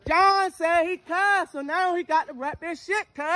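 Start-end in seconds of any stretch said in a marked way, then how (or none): 0.1-1.5 slow, high, stretched-out mockery; slow mocking singsong; drawn-out taunt. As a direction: drag each word out to needle him. Prank host mocks again.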